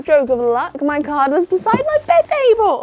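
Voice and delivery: high, loud squeal of luck; delighted squeal. Real, not acted. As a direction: squeal with delight at a lucky find. Delight at finding card.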